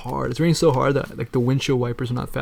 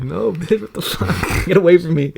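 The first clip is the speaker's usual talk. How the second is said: laughing; 5 semitones higher, 4 dB louder, slower than usual. Retold or imitated. Own retort, laughing.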